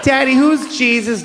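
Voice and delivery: put-on high piping kid voice; a small child asking. Child voice in story.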